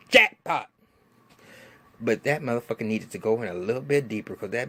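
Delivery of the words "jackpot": higher and louder, triumphant; gleeful cheer. Retold or imitated. Vlogger cheering the stabbing on the show, gleeful shout. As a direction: cheer it like you just won. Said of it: "I was like".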